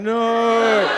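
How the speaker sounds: long, loud slow-motion no; loud, drawn-out cry of horror. Retold or imitated. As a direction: stretch the no like a slow-motion film. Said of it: "watching it fly across the table going".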